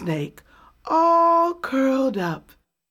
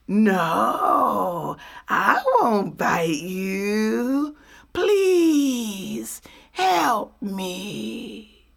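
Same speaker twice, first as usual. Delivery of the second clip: drawn-out pleading snake voice; slow begging snake; much slower than usual. Acted. Snake begging Possum to carry him, slow and pleading. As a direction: beg slowly, sweet and sly.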